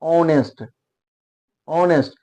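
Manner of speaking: ऑनेस्ट starting straight on the vowel, no h; teacher drills ऑनेस्ट softly with silent h. Shown as a demonstration. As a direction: say ऑनेस्ट as on-est, h dropped. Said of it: "हॉनेस्ट नहीं होता".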